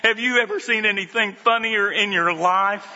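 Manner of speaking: high, lively telling of Janie's laughter; laughing retelling. Retold or imitated. Pastor tells congregation of Janie laughing, high and excited. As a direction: pitch it up as the laughter takes over.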